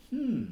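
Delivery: a big sleepy yawn, sliding high; yawn sound. Acted. Storyteller yawning for a sleepy rabbit. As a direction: let out a stretched rabbit yawn.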